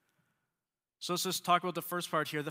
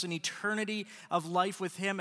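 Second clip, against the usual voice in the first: usual speaking voice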